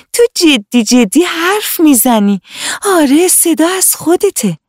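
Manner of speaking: put-on a little girl thrilled by a discovery; amazed little girl. Hana realizes the tree talks.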